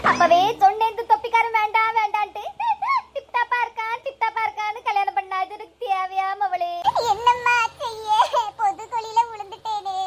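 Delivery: low wailing mother's lament; hammed-up tearful moaning; crying.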